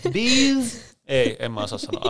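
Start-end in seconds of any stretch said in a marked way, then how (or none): 0.1-1.0 much higher, pushed mock English swear; exaggerated mock accent. Co-host retries the English swear word, far overdone. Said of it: "Ei, toi kuulosti huonolta".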